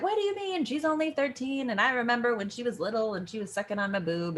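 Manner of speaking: high, mock rambling rant, speeding up; hammed-up chattering protest; Nurse. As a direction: start high in disbelief, then rattle on. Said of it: "this fun nurse rant about, like".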